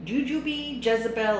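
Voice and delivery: usual speaking voice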